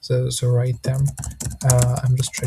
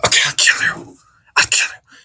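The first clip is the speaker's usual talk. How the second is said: breathy, harsh mock threat, pushed out louder; extremely severe threat; 3 dB louder than usual. Shown as a demonstration. To students who keep talking, a severe mock threat. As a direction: snap a mock death threat at them. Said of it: "be extremely severe with them".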